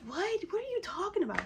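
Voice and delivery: very high, squeaky mock disbelief; alarmed husband. Wife imitates husband not understanding, high-pitched alarm. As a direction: imitate a husband's voice shooting up in shock.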